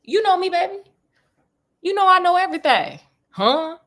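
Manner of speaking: high, teasing, self-satisfied boast; playful cockiness. Retold or imitated. Sherlock brags to Detective Grayson, playfully cocky. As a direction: tease like a smug know-it-all.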